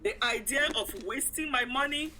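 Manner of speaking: ordinary talk, nothing marked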